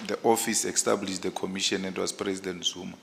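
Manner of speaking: ordinary talk, nothing marked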